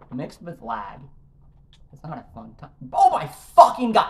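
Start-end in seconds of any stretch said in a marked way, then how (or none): 2.9-4.1 loud, exasperated cry; a gamer losing it after missing the first jump again; furious outburst. Real, not acted. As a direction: explode at the failed jump. Raging at failed jump.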